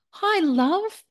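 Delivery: coaxing a teenage son, hammed-up sweetness; imitating a mum. Mother pressing her teenage son to talk, high sugary mock voice. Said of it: "you wanna talk to mum".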